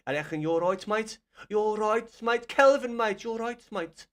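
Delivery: a bad, hammed-up Brummie impression; lightly voiced Birmingham accent, loud and high; mock Brummie accent, said loud and high; terrible Birmingham accent. Shown as a demonstration. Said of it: "I know that's a terrible accent".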